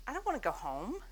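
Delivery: normal talking voice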